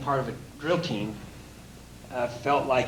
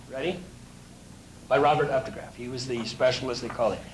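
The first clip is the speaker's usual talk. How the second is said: ordinary talk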